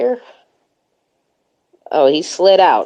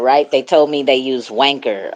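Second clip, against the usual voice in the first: ordinary delivery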